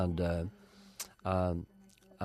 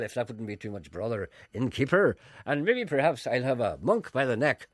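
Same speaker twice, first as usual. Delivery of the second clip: put-on affected Ulster drinker's accent, swelling louder and higher; old literary Ulster accent; 4 semitones higher, 5 dB louder than usual. Poet ordering drinks from innkeeper, affected Ulster accent. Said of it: "everybody had that same accent".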